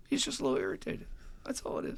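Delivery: high, soft, sarcastic little voice; mock downplaying. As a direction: shrug it off in a tiny mock-innocent voice. Sarcastically echoing Jim's understatement.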